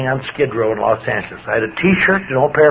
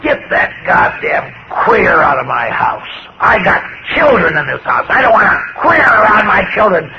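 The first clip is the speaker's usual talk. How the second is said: replaying a drunken rant at a friend, half-acted; retold drunken shriek; 4 semitones higher than usual. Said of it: "shrieked at him".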